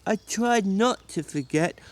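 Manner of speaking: put-on slow, childlike apology; a big simple man pleading with George; Lenny, simple and pleading. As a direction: beg forgiveness like a scolded child.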